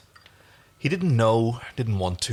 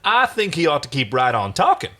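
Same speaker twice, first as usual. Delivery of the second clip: taunting; 5 semitones higher, 5 dB louder than usual. Acted. Crichton taunting, gun drawn.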